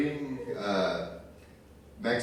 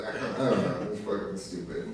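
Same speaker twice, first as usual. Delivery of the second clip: ordinary talk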